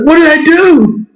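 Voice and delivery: high-pitched mock plea of innocence; squeaky hammed-up bewilderment; retold baffled protest. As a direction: plead innocence in a high voice, replaying the call. Retold protest to angry mom.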